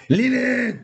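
loud, high shout of the name; excited call. Real, not acted. Cheering a big card pull on stream, excited shout.

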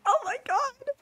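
high squeal of disbelief; excited squeal. Real, not acted. Streamer overwhelmed at finding Lucky, excited squeal.

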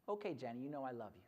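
low, hushed reassurance; tender dad. Acted. Dad relenting to daughter at bedtime, low and tender. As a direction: relent softly and lovingly.

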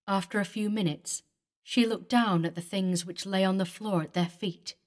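usual speaking voice

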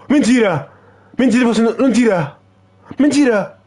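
repeated cries of no way; outraged shouting. Real, not acted. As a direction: yell it like the goal was stolen. Losing a match, protesting.

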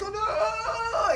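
high, loud drawn-out wail; hammed-up scream of alarm; panicked cry. Sailors on the shaking boat, mock panicked wail.

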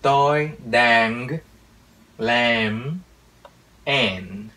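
put-on slow American accent, every final consonant drawn out; American-accented Vietnamese. Teacher imitates an American speaking Vietnamese, slow American accent. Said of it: "cách người Mỹ nói tiếng Việt như thế này".

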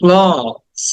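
"ক্লথস" as drawn-out "klawz"; open vowel, final z. Shown as a demonstration. Teacher models for students, "ক্লথস" with open vowel and z. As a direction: hold the "অ" in "ক্লথস", end on z. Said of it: "অ এবং লাস্টে জ".

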